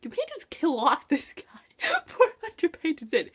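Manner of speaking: incredulous, half laughing; laughing disbelief. Retold or imitated. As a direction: protest through a laugh. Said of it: "and I was just like".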